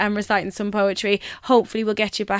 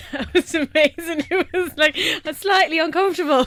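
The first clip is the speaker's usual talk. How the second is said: laughing; 8 semitones higher than usual. Real, not acted. Host laughing after poem.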